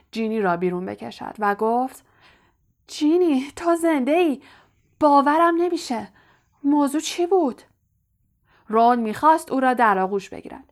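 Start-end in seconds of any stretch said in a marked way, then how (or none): 2.9-7.6 higher, eager and breathless with relief; overjoyed disbelief. A narrator's reading. Ron greeting his rescued sister, higher and excited. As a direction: gush with joyful disbelief.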